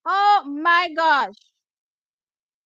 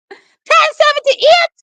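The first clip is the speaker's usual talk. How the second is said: slow, drawn-out cry, much higher and louder; excited shout; 12 semitones higher, 9 dB louder, slower than usual. Real, not acted. Fan shouts the winning time at the screen in disbelief.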